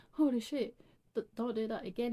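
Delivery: startled loud shriek. Acted. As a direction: yelp it out in alarm. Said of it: "he shrieked".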